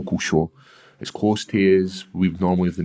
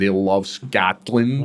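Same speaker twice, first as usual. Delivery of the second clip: slow mock-American "Scotland"; imitating Americans, American vowel drawn out; American accent; much slower than usual. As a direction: stretch "Scotland" with an American "Skaht-land". Mimics how Americans say Scotland.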